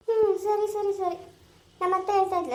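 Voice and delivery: usual speaking voice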